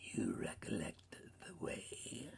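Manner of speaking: soft whispered question; hushed spirit. A narrator's reading. Spirit asking if Scrooge knows the way, hushed. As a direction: ask like an airy phantom.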